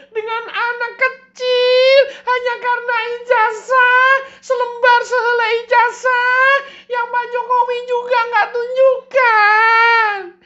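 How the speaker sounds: very high, hammed-up weepy plea; very high, loud, melodramatic mock sobbing; mock crying plea. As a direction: wail it like fake tears on TV. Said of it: "kenapa kamu nangis".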